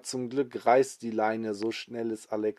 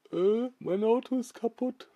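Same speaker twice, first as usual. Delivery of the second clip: high, comic driver complaining; stranded driver; 11 semitones higher than usual. Acted. The man in the stopped car complaining, high comic voice. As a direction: whine that the car broke down. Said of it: "sagt der Mann".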